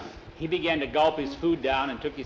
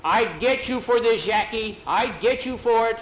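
loud, high-pitched angry threat; furious threat; 7 semitones higher, 6 dB louder than usual. A narrator's reading. Pedro threatens fleeing Jackie for tricking him, furious. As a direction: shout it as a vow of revenge. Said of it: "Pedro was so mad".

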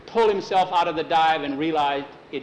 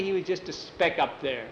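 ordinary delivery

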